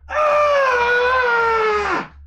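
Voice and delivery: high loud yelp; a sharp startled shout; sudden cry. Real, not acted. Streamer jolts while grabbing pillow, sharp high yelp.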